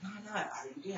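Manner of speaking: high, insistent voice of a prying classmate; pushy classmate. Retold or imitated. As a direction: classmate demanding an answer in a high, eager voice. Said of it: "And he was like".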